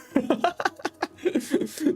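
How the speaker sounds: high breathy chuckle; amused laugh. Real, not acted. Laughs at lack of time.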